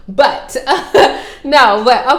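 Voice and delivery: bursts into loud laughter mid-sentence; laughing. Real, not acted. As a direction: laugh it off, then carry on. Laughs at her own teasing.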